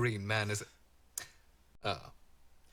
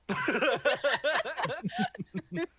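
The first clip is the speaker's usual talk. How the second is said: high, loud burst of laughter; big laugh; 14 semitones higher, 5 dB louder than usual. Real, not acted. GM laughs at request.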